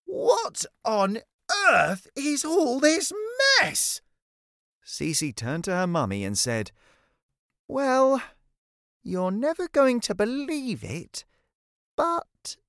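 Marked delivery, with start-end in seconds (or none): 0.0-4.0 high, slow, emphatic outrage; shocked mum. Acted. Mummy discovering the messy bedroom, shocked. Mother finds room in shock. 7.7-12.6 put-on high-pitched child's voice with pauses; girl explaining. Cece explaining the mess to mummy, hesitant.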